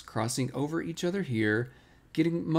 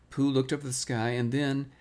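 plain reading voice

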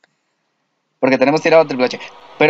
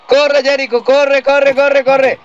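urgent yelling; 10 semitones higher, slower than usual. Real, not acted. Urging character to run.

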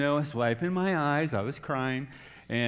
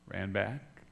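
ordinary talk, nothing marked

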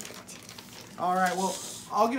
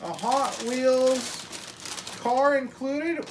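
excited; 4 semitones higher, 4 dB louder, much slower than usual. Real, not acted. Showing toy to child.

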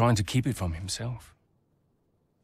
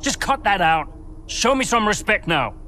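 loud, higher angry scolding; stern rebuke; 9 semitones higher, 6 dB louder than usual. Acted. Team boss scolding driver, loud and angry. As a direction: demand respect, raised voice.

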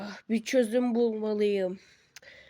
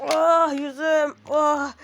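loud ouch over a hurt face; pained cry; 4 semitones higher, 5 dB louder, much slower than usual. Acted. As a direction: cry out as if the face hit the ground. Character hurts face falling. (acıyla bağırarak)

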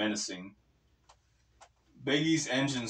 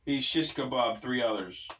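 ordinary delivery

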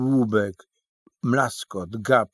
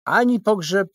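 high character voice; 11 semitones higher, 7 dB louder than usual. Acted. Little man replies.

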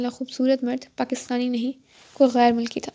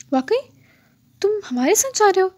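high, fast and thrilled; delighted; 7 semitones higher, 4 dB louder, faster than usual. A narrator's reading. Nisha thrilled the rider joins their tour, excited. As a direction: burst out with happy surprise. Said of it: "از حد خوشی ہوئی".

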